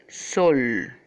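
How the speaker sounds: "sol" in one long, emphatic beat, falling; una sola sílaba. Shown as a demonstration. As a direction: say "sol" as a single drawn-out syllable. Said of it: "un solo tiempo de pronunciación". ("sol" en un solo golpe de voz alargado)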